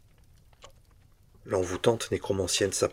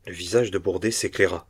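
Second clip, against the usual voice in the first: usual speaking voice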